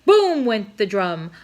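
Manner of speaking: ordinary delivery, nothing marked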